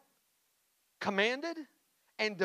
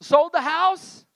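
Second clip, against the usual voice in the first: triumphant announcement; 11 semitones higher, 12 dB louder, slower than usual. Real, not acted.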